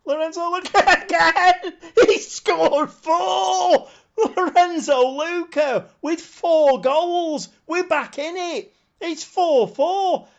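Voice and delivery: high, loud, laughing yells of joy; ecstatic shouting. Real, not acted. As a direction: scream with delight at the four-goal comeback. Comeback goal celebration while playing.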